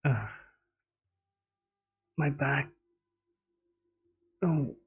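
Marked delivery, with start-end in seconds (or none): none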